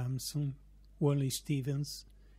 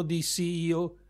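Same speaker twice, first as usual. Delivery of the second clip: ordinary delivery, nothing marked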